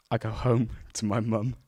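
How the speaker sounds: ordinary talk, nothing marked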